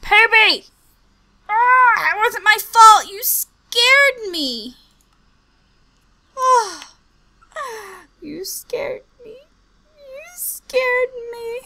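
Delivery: high loud wailing, slow you scared me; real distress; distressed cry. Real, not acted. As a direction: near tears from shock. Crying out after killing villager.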